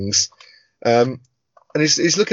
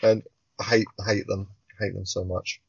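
trailing off; 3 semitones lower, 7 dB quieter than usual. Real, not acted. Fading rant about old men.

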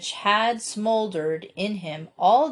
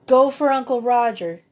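firm order, a bit louder; commanding; 4 semitones higher, 4 dB louder than usual. Acted. Cecily sending someone for Uncle Roger, commanding. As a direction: take charge calmly. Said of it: "commanded Cecily".

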